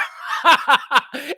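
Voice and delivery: high, loud delighted laugh; joyful laugh. Real, not acted. Fan laughing with joy at the big quarter. Overjoyed laugh after call.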